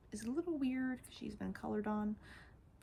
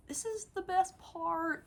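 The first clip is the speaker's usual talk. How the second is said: high, eager build-up; thrilled reveal; excited squeal; 10 semitones higher, 3 dB louder than usual. Real, not acted. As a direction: tease the best bit with glee. Excited intro to the toy.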